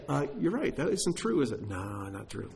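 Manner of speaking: retold grudging climb-down, soft; imitated sheepish concession. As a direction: mimic someone meekly backing down, ending very quietly. Wife concedes in retold exchange.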